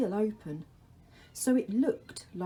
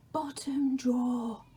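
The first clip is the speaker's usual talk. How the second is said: the Dark's whisper; 6 semitones higher than usual. Acted. The Dark calls to Laszlo in a whisper. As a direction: breathe his name from the shadows.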